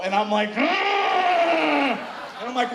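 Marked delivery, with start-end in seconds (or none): 0.5-0.6 long-held high mock moan; hammed-up pleasure groan; acted straining moan. Acting out sex noises.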